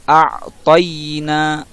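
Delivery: a'toyna drawn out slowly; a'toyna stretched carefully; correct pronunciation. Shown as a demonstration. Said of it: "Jadi dibaca".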